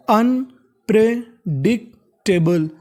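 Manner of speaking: Unpredictable stretched out slowly, sound by sound; slow syllable by syllable. Shown as a demonstration. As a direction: spell out unpredictable slowly for students. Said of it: "अब पूरी मिला के पढ़ते हैं". (धीरे-धीरे शब्द तोड़कर)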